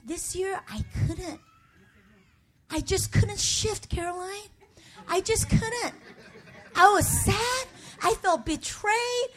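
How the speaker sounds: ordinary delivery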